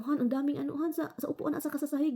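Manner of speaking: high, worried telling; anxious report. Retold or imitated. Telling her husband worms are everywhere, anxious. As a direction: sound rattled.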